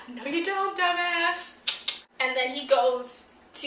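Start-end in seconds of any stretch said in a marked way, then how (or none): 0.1-2.0 mock retort. Acted retort after a mock gasp.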